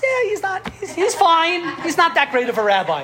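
humble rabbi playing himself down; modest dismissal. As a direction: brush off the praise, drawing it out. Chafetz Chaim downplays himself.

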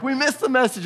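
imitating upset kids, high-pitched; high retold wail, lightly done; mock crying. Boys grieving the missed Jumbotron message, mock crying. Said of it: "crying and sad".

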